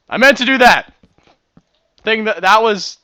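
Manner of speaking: loud playful protest. Real, not acted. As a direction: insist loudly, half joking. Covering a mistake in game.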